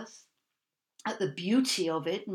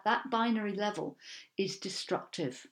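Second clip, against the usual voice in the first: usual speaking voice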